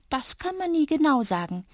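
high-pitched little creature voice; little creature explaining. A narrator's reading. (kleines Wesen, hohe Stimme)

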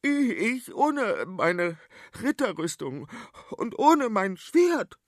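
high, very slow, halting; quavering high scared boy; frightened stammer. Acted. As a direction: stumble out the excuse in panic. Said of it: "stammelte Wendelin".

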